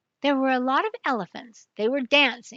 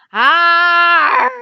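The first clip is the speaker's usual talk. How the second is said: loud, high wailing cry; a big howl from far off; 6 semitones higher, 14 dB louder than usual. Acted. Unseen crocodile wails loudly in the jungle, loud cry. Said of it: "they heard a loud sound".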